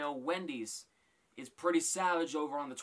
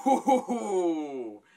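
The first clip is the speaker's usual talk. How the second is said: shocked ooh; 3 semitones higher, 10 dB louder than usual. Real, not acted. Reacting to a savage roast.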